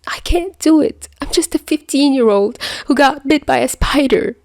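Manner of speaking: put-on a hurt fifteen-year-old fighting tears; upset boy voice rising toward the end; tearful teen. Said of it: "his tears wouldn't stop".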